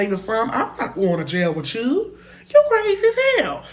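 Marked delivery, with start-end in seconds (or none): 2.5-3.6 much higher and louder, near a yell; carried away retelling it; excited shout. Real, not acted. Hyped up telling her audience she ordered him to drive off, high excited shout.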